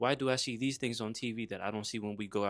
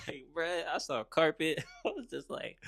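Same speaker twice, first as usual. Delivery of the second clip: high-pitched talk through laughter; amused, giggling disbelief; laughing; 6 semitones higher than usual. Real, not acted. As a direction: tell it while cracking up. Laughing while recalling first carpet.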